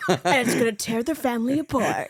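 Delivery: loud, hammed-up trailer line; playfully dramatise it like a TV intro; mock show announcer. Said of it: "the guy with the deep voice".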